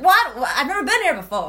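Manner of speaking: loud, high bewildered what; baffled tourist voice; confused. Retold or imitated. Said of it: "I was like".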